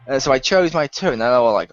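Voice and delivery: usual speaking voice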